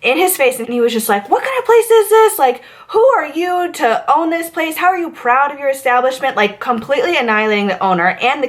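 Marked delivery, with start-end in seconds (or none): 1.3-6.4 mock furious yell. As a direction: tear into the owner like a raging guest. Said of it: "this man loses his marbles".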